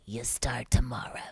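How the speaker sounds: low, hushed man's voice; mock agent in a near whisper; imitating the manager. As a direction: drop low for the manager's line. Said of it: "he talked like this".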